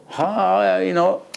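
hammed-up throaty "Ha"; a theatrical over-done throat sound; mock showy guttural; mock Broadway guttural. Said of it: "a Broadway show".

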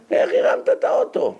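amazed question. Retold or imitated. Asking boy who lifted car.

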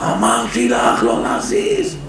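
high, slow mock scream; retold scream. Husband shrieking at his wife over the fan, high mock scream. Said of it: "מתחיל לצרוח".

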